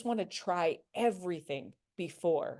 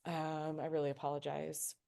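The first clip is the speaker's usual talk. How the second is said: soft, softer empathetic demo; sympathetic apology; 3 semitones lower, 8 dB quieter than usual. Shown as a demonstration. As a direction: show a gentle, sorry tone. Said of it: "First, we have to say".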